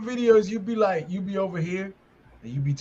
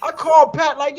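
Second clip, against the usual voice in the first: normal talking voice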